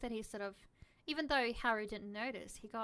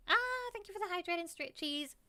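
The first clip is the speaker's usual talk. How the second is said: playful thanks; 8 semitones higher, 3 dB louder than usual. Real, not acted.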